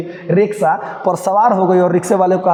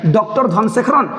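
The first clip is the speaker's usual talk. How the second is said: ordinary talk, nothing marked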